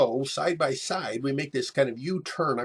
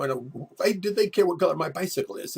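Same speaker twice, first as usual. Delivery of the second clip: ordinary talk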